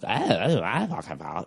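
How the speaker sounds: low, soft garbled protest; mock excuse-maker. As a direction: mumble a mocking, wobbly excuse. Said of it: "people call 'em on 'em".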